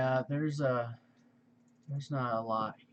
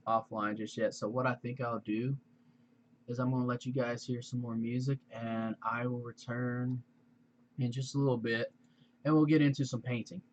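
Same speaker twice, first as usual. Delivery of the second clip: ordinary talk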